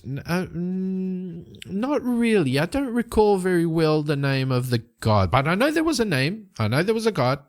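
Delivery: high-pitched, hedging elf; flustered evasion. Acted. Elf evasive about forgotten god's name.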